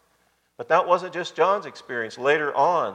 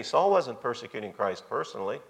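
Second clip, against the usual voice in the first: ordinary talk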